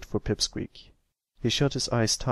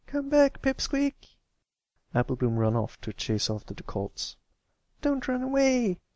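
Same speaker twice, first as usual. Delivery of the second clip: calling after. A narrator's reading. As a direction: shout after him as he runs. Applebloom chasing, calling after him.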